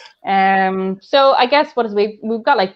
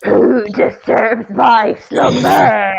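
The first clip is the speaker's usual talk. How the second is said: very slow booming cave voice; Cave of Wonders; much slower than usual. Retold or imitated. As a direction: boom it out like a giant talking cave. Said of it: "sounds like the cave in Aladdin".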